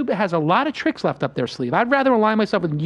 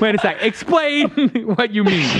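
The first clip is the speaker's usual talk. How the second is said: real laughter through the words; laughing disbelief; 3 semitones higher, 3 dB louder, slower than usual. Real, not acted. Host stopping guest after a bold prediction, laughing. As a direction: halt the guest, cracking up in amazement.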